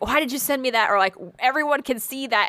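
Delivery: usual speaking voice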